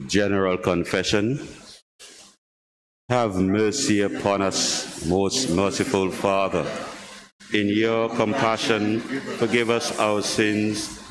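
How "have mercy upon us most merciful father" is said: usual speaking voice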